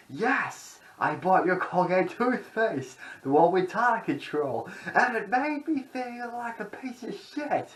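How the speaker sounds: high, strained cartoonish rant; high-pitched mock outburst about toothpaste; Tourette's guy outburst. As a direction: rant it like a crazed prank-video character. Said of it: "the Tourette's guy's like".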